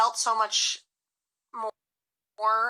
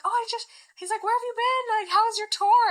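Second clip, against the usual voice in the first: voiced ex; 12 semitones higher than usual. Ex voiced in story.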